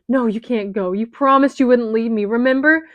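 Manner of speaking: loud, rising frantic pleading; loud tearful pleading; desperate plea. Acted. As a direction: plead with him not to go. Pleading with dying boy.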